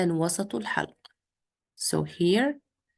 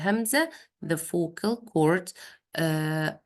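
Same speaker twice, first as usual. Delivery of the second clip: ordinary delivery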